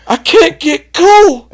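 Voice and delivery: high, loud, slow wailing complaint; high panicked cry. Retold or imitated. Drunk telling friends he's numb, high wailing cry. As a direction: cry it like you're scared of dying. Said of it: "he goes".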